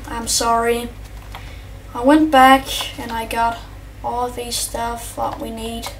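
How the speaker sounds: ordinary delivery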